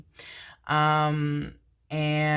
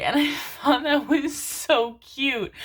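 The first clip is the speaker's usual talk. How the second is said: laughing; 9 semitones higher, 4 dB louder, slower than usual. Real, not acted. Laughing at sweet encounter.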